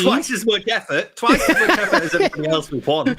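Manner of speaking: high, loud laughing remark. Real, not acted. Cohost laughing at routine.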